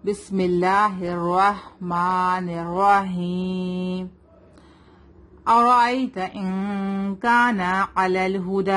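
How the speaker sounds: slow measured tajweed recitation; teacher's model recitation; Quranic recitation. Shown as a demonstration. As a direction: recite it slowly, drawing out the vowels.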